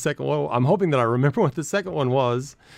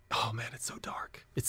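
hushed whisper about something grim; 13 dB quieter than usual. Real, not acted. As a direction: whisper it like a secret. Hushed aside about dark show.

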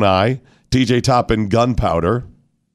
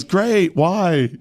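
puzzled; 8 semitones higher than usual. Retold or imitated. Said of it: "one of them goes".

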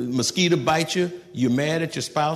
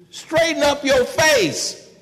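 higher, louder, slow scold; parent scolding; 12 semitones higher, 5 dB louder, slower than usual. Retold or imitated. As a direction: bark it at a sulking child slowly. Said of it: "That's what you tell your children".

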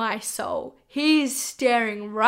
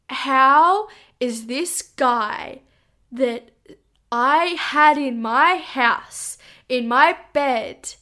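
slow, loud, word-by-word emphasis; stunned disbelief; 6 dB louder, much slower than usual. Retold or imitated. She relives reading his conviction online, in stunned, emphatic disbelief. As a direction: hammer each phrase slowly in disbelief. Retold shock at reading the article.

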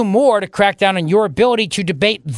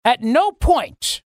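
ordinary talk, nothing marked

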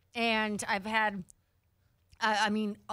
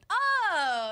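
surprised oh; 8 semitones higher, 5 dB louder than usual. Retold or imitated. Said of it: "He's like".

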